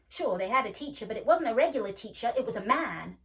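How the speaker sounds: put-on loud, insistent boy's retort; boyish argument. A narrator's reading. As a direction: overrule her loudly. Boy argues, know-it-all.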